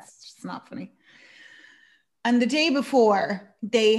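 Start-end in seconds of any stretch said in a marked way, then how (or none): 0.0-0.9 whispered and much softer, unimpressed; whispered retort. Retold or imitated. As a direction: breathe it out with no voice, not amused.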